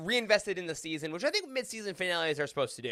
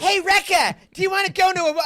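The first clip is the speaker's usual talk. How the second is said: high, loud mock call; mock excited invitation; 12 semitones higher, 9 dB louder than usual. Host acts out someone inviting Rekka along, mock excited call. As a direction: call it out high and eager, hammed up.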